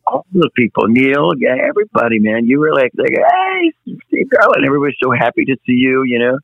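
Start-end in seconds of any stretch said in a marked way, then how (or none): 3.1-4.7 high, bright mock hello from a fan; imitating a fan's high greeting; excited greeting. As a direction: call his name like an eager fan. Fans greeting him at awards.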